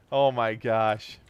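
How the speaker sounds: normal talking voice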